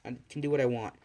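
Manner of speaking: usual speaking voice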